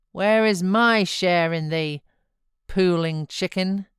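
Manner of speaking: loud, rough, mocking father. A narrator's reading. Heathcliff handles his weeping son, loud and rough. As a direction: chide the crying boy roughly.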